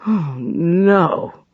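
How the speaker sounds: a mild gasped dismay. A narrator's reading. Farrell hearing the manuscript was burned, mildly gasped. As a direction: let the breath catch on it. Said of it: "gasped Farrell".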